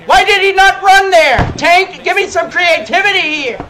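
loud, high exasperated question; exasperated complaint. Real, not acted. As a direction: complain loudly at your own player. Complaining about his player.

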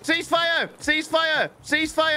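high urgent call; shouting orders over battle. Acted. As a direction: call the ceasefire.

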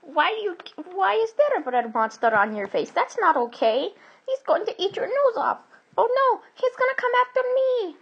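high-pitched cartoon alarm; Luigi in a panic. A narrator's reading. Luigi warns Mario about the bread monster, panic rising. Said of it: "said Luigi".

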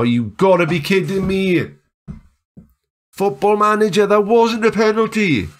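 high-pitched, indignant cry; exasperated, high wail at the game; outraged protest. Real, not acted. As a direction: protest the decision in real disbelief. Protesting a penalty decision.

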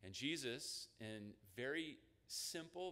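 ordinary delivery

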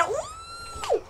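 long high squealed "ooh"; a drawn-out, high-pitched startled whoop. Real, not acted. Player startled mid-fight, squealed ooh.

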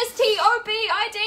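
high, loud, fast spelling; mock spelled yell. Therapist yelling a spelled-out order, loud and fast. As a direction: rattle off each letter shouting. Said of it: "And then he's like".

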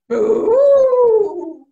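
booming, drawn-out ghost call; Papa Ghost wail. Retold or imitated. Spider mimics Papa Ghost, loud wail. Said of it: "Papa Ghost goes".

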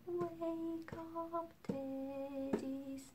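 barely above a whisper; quiet. Shown as a demonstration. Let's do it louder.

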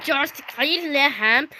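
normal talking voice

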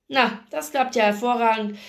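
ordinary delivery, nothing marked

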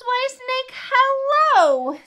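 very high, swelling sing-song hello; exuberant greeting. A narrator's reading. Mouse hails snake before Gruffalo, high and exuberant. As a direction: call out with showy delight.

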